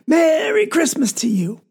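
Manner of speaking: Papa waving the chickens at his children, a mild mock yell. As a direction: call it out happily to the kids. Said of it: "waving them two chickens and yelling".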